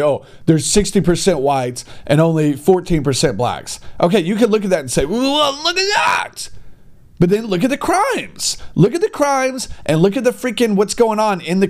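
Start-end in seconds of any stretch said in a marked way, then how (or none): 5.0-6.5 higher, slow mock shout; hammed-up mock outcry; mock exclaim. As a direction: call it out in mock amazement. Mock reaction of critics.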